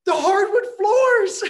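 high, loud, slow cry of dismay; horrified gasp. Retold or imitated. Mom mourns her hardwood floors, high loud wail. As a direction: wail it like the floors are already ruined. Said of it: "She gasped".

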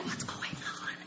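hushed worried whisper; whispered mom. Retold or imitated. Mom whispering at her daughter's bedroom door. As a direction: whisper it, puzzled.

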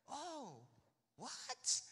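high, puzzled "oh"; imitated disbelief rising sharply at the end; surprised. Friends learning of the theology plan, surprised. Friends react to career news.

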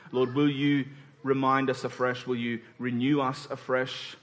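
hushed pastoral prayer; closing prayer. Acted.

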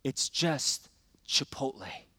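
soft, low, halting delivery of her line; quietly quoted wife. Retold or imitated. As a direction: let the retold rebuke land softly, with pauses. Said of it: "And then she said this".

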